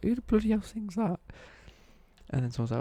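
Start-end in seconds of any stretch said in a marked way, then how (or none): 0.0-1.2 high-pitched incredulous retold question; mock bafflement. Retelling to viewers a puzzle at work, mock bafflement. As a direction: act out being utterly baffled, pitched right up.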